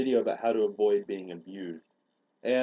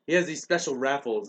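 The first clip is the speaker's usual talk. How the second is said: usual speaking voice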